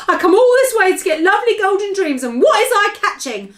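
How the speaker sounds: high, loud aggrieved lament; distressed. Acted. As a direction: complain bitterly to Sophie. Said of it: "more distressed every moment".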